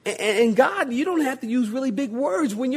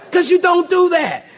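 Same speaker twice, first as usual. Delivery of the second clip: usual speaking voice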